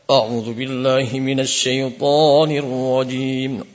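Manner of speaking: slow chanted A'udzubillahi with a rounded o; too stylish A'udzubillahi minasyaitonirrajim; showy o vowel. Shown as a demonstration. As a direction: overdo the style, turning a into o. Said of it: "Seperti orang yang mungkin terlalu bergaya".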